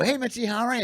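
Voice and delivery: retold hopeful hello, pitched high; eager greeting. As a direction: greet the boss a little too eagerly. Hopeful young comic greets club owner.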